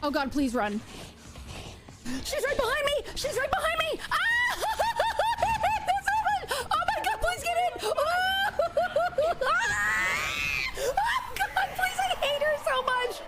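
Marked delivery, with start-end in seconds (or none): 2.2-13.2 very high screaming with panicked laughter; terrified laughing screams. Real, not acted. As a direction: scream and laugh while fleeing. Monster chasing in game.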